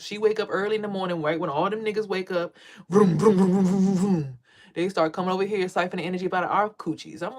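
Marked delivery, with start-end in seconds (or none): 2.9-4.4 mock engine revving; imitated engine noise; engine vroom. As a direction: rev it like a car engine.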